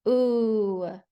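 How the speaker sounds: "oo" held alone as in moon; long oo sound. Shown as a demonstration. Teacher sounding out balloon, long isolated "oo". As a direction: stretch "oo" as a single pure vowel. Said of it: "are making the oo sound today".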